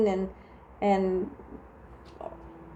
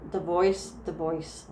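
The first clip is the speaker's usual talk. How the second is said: "divorce" said "divoice" in a Jersey accent, no r; 4 semitones lower, 5 dB quieter than usual. Real, not acted. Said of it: "that's my Jersey accent coming out there".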